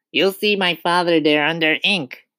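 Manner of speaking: put-on high-pitched boy's voice; Eric Ink. A narrator's reading. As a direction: explain it as a small pupil. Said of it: "Eric said".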